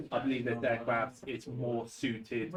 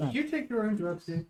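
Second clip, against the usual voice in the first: NPC voice; 8 semitones higher than usual. Acted. GM voices NPC questioning player.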